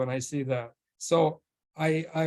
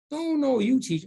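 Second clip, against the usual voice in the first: insisting; 9 semitones higher than usual. Retold or imitated. Retold back-and-forth over who teaches.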